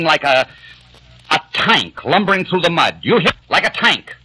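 angry tirade. Acted. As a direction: pour scorn on each of them in turn. Peter denounces Kate and Lady Anne.

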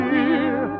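normal talking voice

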